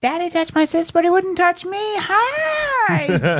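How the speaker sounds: piercingly high mock-girly introduction; over-sharing date. Comic parodying a date introducing herself, falsetto over-sharing. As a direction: squeal it in a hammed-up falsetto.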